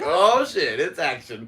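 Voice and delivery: high, loud excited exclamation; light up at the sudden action. Retold or imitated. Cop gets a jumper call, excited exclamation.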